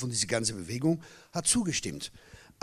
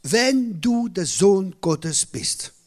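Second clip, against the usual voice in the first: a slow, loud hint of the devil taunting; 4 semitones higher, 6 dB louder, much slower than usual. Preacher quoting the devil tempting Jesus, slow and taunting. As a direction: drag out the tempter's challenge. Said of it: "der erste Angriff vom Teufel".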